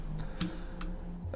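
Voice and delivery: quiet trailing laugh; breathy little chuckle to oneself; soft chuckle. Real, not acted. Gamer amused at opening the helmet, soft chuckle.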